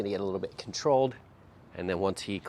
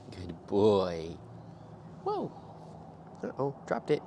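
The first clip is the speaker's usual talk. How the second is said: ordinary talk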